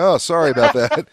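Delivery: high, loud mock apology; sheepish apology. Wrong guesser apologizing to a local, mock sheepish. Acts out his embarrassed apology.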